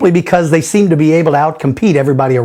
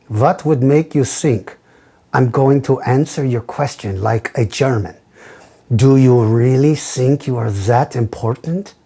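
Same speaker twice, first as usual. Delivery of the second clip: put-on German accent; 4 semitones lower, 3 dB quieter than usual. Host teasing a fan who asked for it, German accent. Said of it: "in your hilarious German accent".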